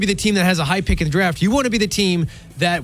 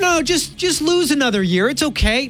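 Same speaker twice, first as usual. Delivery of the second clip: sarcastic acceptance; 7 semitones higher than usual. Acted. Sarcastically voices accepting another losing year.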